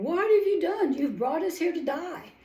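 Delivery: high, loud desperate cry; crying out in fear. Acted. Frightened Israelites crying out to Moses at the sea, loud and high. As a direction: shout it up at Moses in panic. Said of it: "crying out to Moses and saying".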